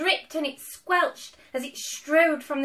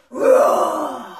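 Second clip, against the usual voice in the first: demonstrated roar; 2 semitones higher, 14 dB louder than usual. Shown as a demonstration. Reader showing child the right kind of roar, loud roar. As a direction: roar like a scary beast. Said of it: "Like this".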